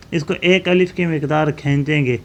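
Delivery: ordinary talk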